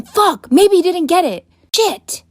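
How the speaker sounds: high, slow mock shout; imitated shouting. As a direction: imitate a friend yelling in alarm, pitch way up. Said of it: "He started shouting".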